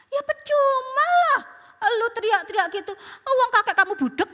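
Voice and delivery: high teasing mom, ending in a laugh; mother's retort. Retold or imitated. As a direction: tell the kid it's no use, amused. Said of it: "mamanya bilang gini". (mama menyindir sambil tertawa)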